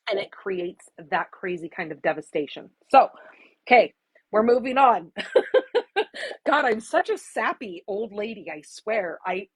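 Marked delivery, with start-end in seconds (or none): 5.2-6.4 high breathy laugh at herself; sheepish little giggle; embarrassed laugh. Real, not acted. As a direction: laugh off the tears. Laughs at herself after tearing up.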